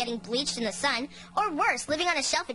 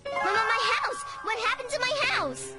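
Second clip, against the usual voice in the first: high-pitched panic; shocked, voice shooting up high; alarmed cry; 8 semitones higher than usual. Acted. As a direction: cry out in horror at the loss. Wakes to find house gone.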